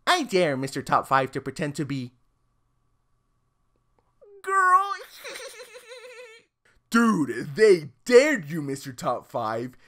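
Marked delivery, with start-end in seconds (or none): 4.4-6.9 put-on high falsetto girl word; squeaky girl voice. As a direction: pop out a girly squeal.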